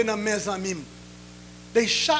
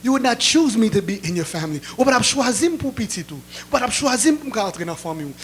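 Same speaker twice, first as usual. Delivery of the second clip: ordinary talk, nothing marked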